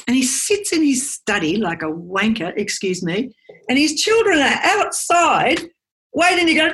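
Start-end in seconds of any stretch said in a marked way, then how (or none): 3.7-6.7 rising urgency. Acted. Children waiting outside the study.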